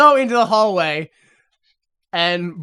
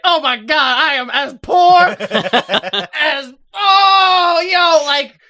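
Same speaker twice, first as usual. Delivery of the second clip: mock outrage; 7 semitones higher, 5 dB louder, slower than usual. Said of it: "And I was like".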